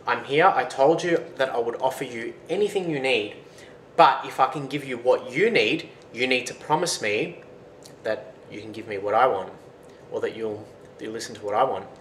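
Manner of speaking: usual speaking voice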